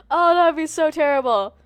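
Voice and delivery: normal talking voice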